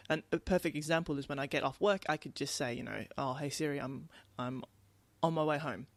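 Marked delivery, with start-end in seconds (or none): none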